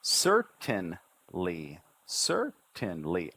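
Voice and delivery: "Certainly" very slow, a push on each syllable. Shown as a demonstration. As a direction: push "cer", "tain", "ly" one by one. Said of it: "I push with each syllable".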